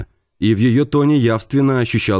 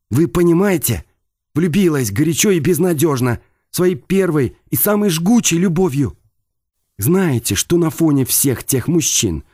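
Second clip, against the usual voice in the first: higher, irritated woman's voice; 7 semitones higher than usual. Acted. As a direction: talk higher, with rising irritation. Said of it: "нотки раздражения".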